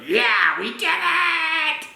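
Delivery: high, drawn-out victory cheer; cheering fairy. Acted. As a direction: celebrate like you just won. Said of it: "She's like".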